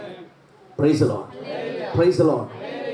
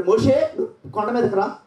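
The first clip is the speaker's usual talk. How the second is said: high, commanding call from the mountain; voice of God; 11 semitones higher than usual. Retold or imitated. God calling Moses up the mountain for the commandments, high and commanding. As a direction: summon Moses with authority. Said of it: "దేవుడు అన్నాడు".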